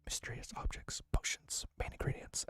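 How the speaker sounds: hushed, breathy whispered lecture; ASMR whisper. Shown as a demonstration. Streamer practicing an ASMR lore video, whispering. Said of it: "I'm practicing an A S M R lore video".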